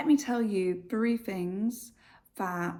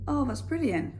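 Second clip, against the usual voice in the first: sarcastic brilliant; 6 semitones higher than usual. Shown as a demonstration. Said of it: "So if I sit there and say".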